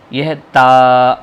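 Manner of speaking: slow teaching call of the letter; drawn out letter. Shown as a demonstration. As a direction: stretch out تا clearly. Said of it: "کھینچ کر پڑھیں گے".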